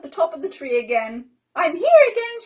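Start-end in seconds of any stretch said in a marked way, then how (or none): 1.5-2.4 put-on high, loud cheery child voice; Pippi popping up. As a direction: announce it brightly. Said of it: "she said".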